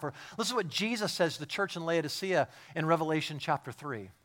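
usual speaking voice

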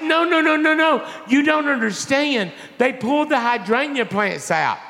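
high-pitched mock wailing protest; distraught insistence. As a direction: plead high and frantic, hamming up the upset. Acts out his distraught insistence.

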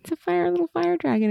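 squeaky cute voice for a little dragon; cutesy baby voice. Acted. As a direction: coo it like you're talking to a tiny pet dragon. I love the adorable magic.

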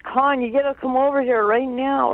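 lightly acted excited summons; excited retold call. Brother's excited call from Germany, retold.

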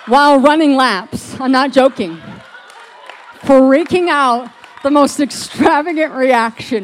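loud, excited storytelling; excited retelling. Real, not acted. Telling the crowd about his wild reaction, loudly excited. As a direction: let the excitement burst out loud.

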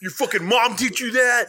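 high, loud teasing yell; mock outrage. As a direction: shout it as a playful insult. Mock scolding about pronunciation.

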